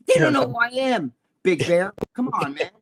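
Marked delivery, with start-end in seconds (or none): none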